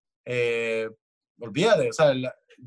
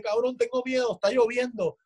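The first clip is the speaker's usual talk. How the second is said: mock fear; 7 semitones higher than usual. Voicing scared person quote.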